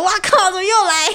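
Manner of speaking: high-pitched exasperated wow, here he goes again; exasperated disbelief. Retold or imitated. 她看到他又来问时，又高又恼的惊呼. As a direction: throw up your hands at the same question.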